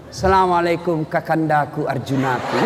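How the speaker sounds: a loving wife greeting her husband; wife greeting her returning husband, tender and adoring; sweet wife voice. Retold or imitated. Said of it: "kalau istri tak baik bicaranya".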